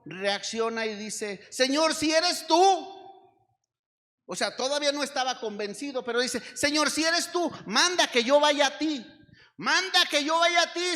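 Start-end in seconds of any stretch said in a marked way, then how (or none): 1.5-3.0 loud, high and slow plea; fervent call. Acted. Peter calls to Jesus on water. 6.6-11.0 loud, high urgent begging; pleading cry. Acted. Peter begs to walk to Jesus.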